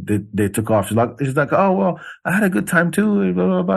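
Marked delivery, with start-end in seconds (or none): 1.5-3.8 higher, lightly mimicking her sweet farewell; lightly imitated woman saying goodbye; mock polite goodbye. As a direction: parrot her polite exit a touch higher.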